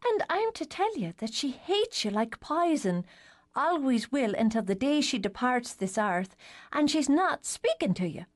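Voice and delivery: put-on high, girlish voice; light teen girl voice; little sister voice. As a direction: play the cheeky youngest sister. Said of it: "Alice May relays Mary Kate".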